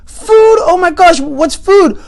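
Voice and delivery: high, loud burst of astonishment; amazed alien. Acted. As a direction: gasp it out in wonder, high-pitched. Alien amazed on hearing of food.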